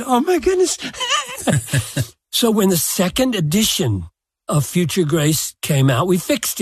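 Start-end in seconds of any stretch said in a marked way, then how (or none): 0.0-0.8 high, startled "oh my goodness"; shocked realization. Retold or imitated. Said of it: "I looked at it and said". 0.8-2.0 high laugh. Real, not acted. Laughing at his own mistake.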